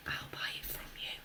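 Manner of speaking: breathy secret whisper. A narrator's reading. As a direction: whisper the offer so nobody hears. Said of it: "the man whispered".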